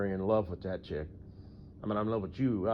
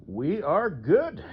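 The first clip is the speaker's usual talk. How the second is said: mock chipper; 8 semitones higher, 6 dB louder, much slower than usual. Answering his own sarcastic question.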